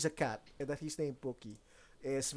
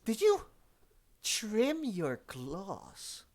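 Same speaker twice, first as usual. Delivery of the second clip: much higher, drawn-out cat voice; snooty cat squeak; 8 semitones higher, 3 dB louder, much slower than usual. Acted. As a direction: squeal it, then stretch it slowly. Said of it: "he says".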